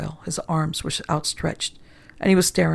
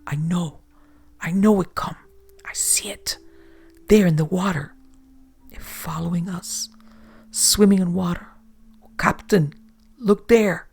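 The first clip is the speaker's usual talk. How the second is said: frightened whisper. A narrator's reading. Terrified sailor sees monster.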